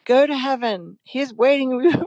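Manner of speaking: sing-song it like a doting movie mom; high lilting imitation of a mother; imitating the movie mother. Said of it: "like the mother in the movie".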